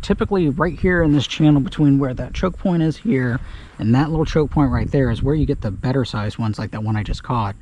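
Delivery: ordinary talk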